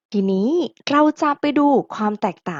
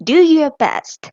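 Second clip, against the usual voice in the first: model phrase; 6 semitones higher, 7 dB louder, much slower than usual. Shown as a demonstration. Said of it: "teacher models example phrases".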